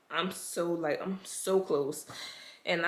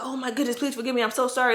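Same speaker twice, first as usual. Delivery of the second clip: higher, gushing mock apology; apologetic lady; 6 semitones higher, 4 dB louder, faster than usual. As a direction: imitate the flustered nurse saying sorry. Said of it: "And she was like".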